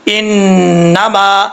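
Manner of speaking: انما with humming nn, then stretched aa; very slow tajweed demo; held ghunnah. Shown as a demonstration. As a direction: linger on the n of انما. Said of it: "غنہ کر کے پڑھا جائے گا".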